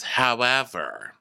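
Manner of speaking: slow, stretched-out "However" with a falling end; drawn-out however. Retold or imitated. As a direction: drag out the word "However" slowly. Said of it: "So she's like".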